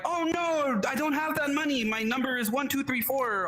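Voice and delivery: a gullible caller blurting out in a fake fluster; panicked victim. A scam victim to the fake bank: mock panicked, high pitched. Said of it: "that be like".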